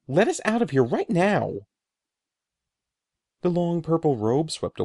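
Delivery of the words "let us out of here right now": high, loud, shrill demand; a boy shrieking to be let out. A narrator's reading. Brother demanding release, shrill loud cry. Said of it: "Eddie demanded shrilly".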